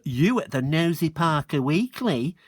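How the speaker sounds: a light mock of a pretty little girlish voice; mildly mimicking girl's voice; mocking her voice. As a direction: parrot her back sarcastically. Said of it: "imitating her pretty little voice".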